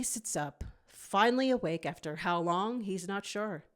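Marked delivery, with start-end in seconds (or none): none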